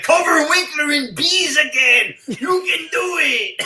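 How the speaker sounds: high, loud, slow yell; high laughing mock shout; mock cheering shout. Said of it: "when he's like".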